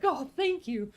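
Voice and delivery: high, mock-grateful gushing; playfully hammed-up gratitude; flattered reply. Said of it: "And I'm like".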